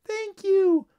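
tiny very high squeaky cheer; loud, piping bug chorus; crying-out ladybugs. A narrator's reading. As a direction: squeal thanks as little ladybugs. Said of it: "cried a chorus of ladybugs".

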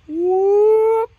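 high, loud rising "whoop"; sliding whistle-like hoot, climbing as it flies; imitated ball whoosh. Here comes the ball, imitated whoosh.

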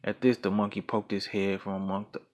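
ordinary delivery, nothing marked

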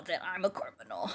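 low, quick drop into a croak; low mock confession. Player admitting to being a criminal, low mock confession. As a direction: say it low and fast.

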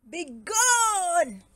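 high, loud, furious bellow; thundering bear outburst; roar. Acted. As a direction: roar to banish the intruder. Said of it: "roared Bear".

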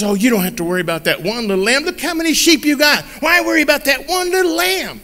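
a wife shrugging it off; wife telling husband not to worry; wife's voice. Acted.

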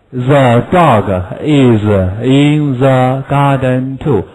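slow, clear drill reading; slow model sentence. Shown as a demonstration. Teacher modelling a sentence for pupils. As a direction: spell it out slowly.

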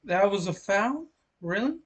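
pitched high in disbelief; incredulous. Real, not acted. Disputed foul call.

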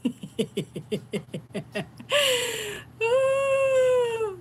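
staccato laugh rising into a high wheeze; long high-pitched breathless laugh; embarrassed laughing fit. Real, not acted. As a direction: crack up helplessly, then squeal. Embarrassed at being heard screaming.

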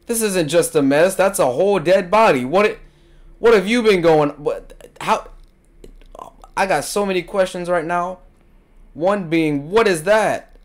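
loud, high shocked babble; shocked outburst. Real, not acted. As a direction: freak out at the body, voice climbing. Finds a dead body, freaks out.

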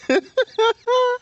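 shrill burst of laughter; high squealing laugh. Real, not acted. Laughing at friend.

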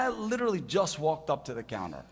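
ordinary talk, nothing marked

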